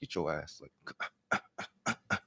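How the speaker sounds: hushed God. Retold or imitated. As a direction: mutter it under the breath. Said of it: "God's like".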